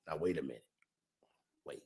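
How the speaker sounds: hushed, conspiratorial wait; breathless whispered realization; whispered aside. Real, not acted. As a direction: lean in and whisper it.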